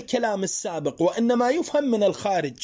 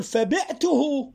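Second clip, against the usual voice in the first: فبعته drawn out slowly with a rising end; slow teacher demo; slow example; 5 semitones higher, much slower than usual. Shown as a demonstration. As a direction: stretch فبعته for the class. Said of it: "أو تقول".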